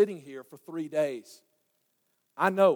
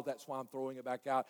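ordinary talk, nothing marked

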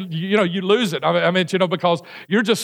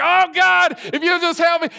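mock screaming prayer; 10 semitones higher than usual. Rider falling on the coaster, mock screaming plea to God. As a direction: cry out to God mid-drop. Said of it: "you're screaming like".

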